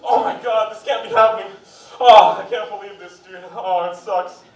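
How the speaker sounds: hammed-up anguish over nothing; emotional viewer wailing at a commercial; overreacting moviegoer. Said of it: "I hear".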